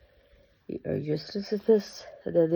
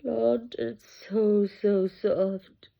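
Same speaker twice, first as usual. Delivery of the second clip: usual speaking voice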